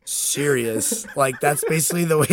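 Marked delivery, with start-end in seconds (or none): 0.0-1.1 slow incredulous question, laughing; mock disbelief. Voicing the bears looking at this man, mock disbelief. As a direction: play baffled, amused onlookers.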